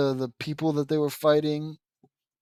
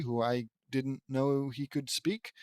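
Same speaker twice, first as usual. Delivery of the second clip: ordinary delivery, nothing marked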